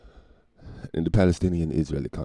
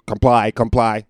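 loud, high mock plea, slow at the end; mocking government voice; 11 dB louder, slower than usual. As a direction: beg them to comply like a fake authority. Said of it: "just say".

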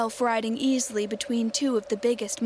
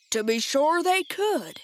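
higher, drawn-out exclamation; storybook cry of agreement, slow and raised; 5 semitones higher, slower than usual. A narrator's reading. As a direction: call it out as an eager Scarecrow. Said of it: "cried the Scarecrow".

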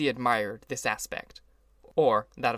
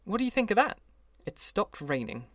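eager, higher announcing; excited; 8 semitones higher than usual. A narrator's reading. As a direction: share the good news gleefully. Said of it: "repeated the news to Daisy".

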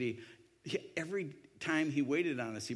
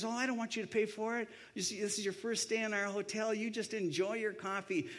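high-pitched, insistent waiter voice; putting on a hospitable server's voice; generous waiter; 9 semitones higher than usual. Retold or imitated. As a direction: imitate a host waving off payment. Voicing generous waiter waving off payment.

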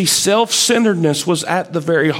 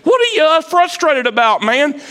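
pitched way up, mock disbelief; incredulous high question; 12 semitones higher than usual. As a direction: ask it like you can't believe him.

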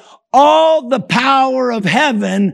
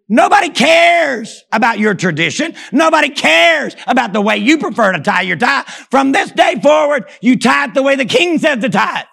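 shouting; 4 semitones higher than usual. Acted. Preacher shouting the king's rules at the congregation.

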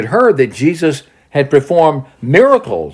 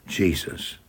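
ordinary talk, nothing marked

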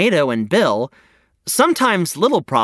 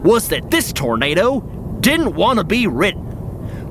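ordinary talk

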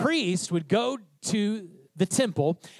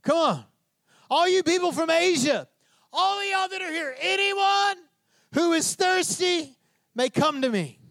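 high, drawn-out proclamation to a huge crowd; full-voiced open-air preacher's call; shouting to crowds; 9 semitones higher than usual. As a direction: shout the invitation out to everyone. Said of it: "stood up and shouted to the crowds".

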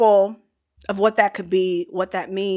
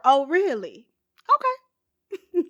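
sassy dismissal; 6 semitones higher, slower than usual. Retold or imitated. Spartans' sassy dismissive reply.

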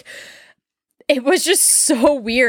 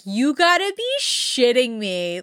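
higher, slow, drawn-out incredulity; exasperated disbelief; 4 semitones higher, slower than usual. Retold or imitated. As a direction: stretch the words in acted exasperation. Acted reaction to the heavy breathing.

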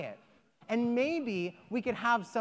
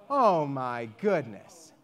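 mild, lower, slow worn-out sigh; weary sigh; 8 semitones lower, slower than usual. Acted. The woman after a sleepless night, mild weary sigh. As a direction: sag with a touch of exhaustion.

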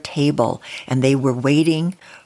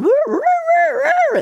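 high loud woo yelps; pelted coyotes yelping high; coyotes howling woo; 5 dB louder than usual. Acted. Coyotes pelted with pine cones.

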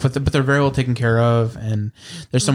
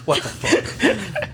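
baffled; much slower than usual. Retold or imitated. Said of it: "the look on Art's face".

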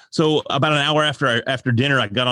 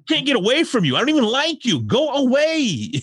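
higher, hammed-up teasing complaint; mock exasperation; 11 semitones higher than usual. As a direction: tease a friend who's always around. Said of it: "they're like".

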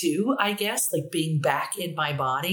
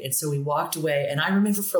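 ordinary delivery

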